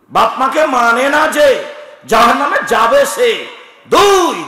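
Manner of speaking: loud, high sermon cry, stretching each condemnation; fiery preaching. Acted. Preacher warning the congregation, shouting at full force. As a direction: hammer every sin at the crowd.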